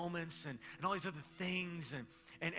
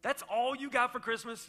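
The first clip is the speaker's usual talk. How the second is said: mock scolding dad; 3 semitones higher, 7 dB louder than usual. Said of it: "wanted to bust up in that conversation and be like".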